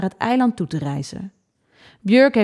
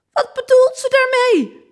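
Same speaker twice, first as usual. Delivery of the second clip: put-on high, loud girl's outburst; shouted question; 4 dB louder than usual. A narrator's reading. Girl crying out through grandma's speech, shouting. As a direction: yell it out in alarm. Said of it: "schreeuwde ik".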